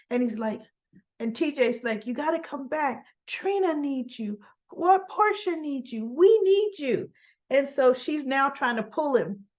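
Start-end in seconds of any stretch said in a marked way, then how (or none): 2.1-7.1 pleading. Retold or imitated. Nephew begs his dying uncle to come back, high and pleading. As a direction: beg him to come back.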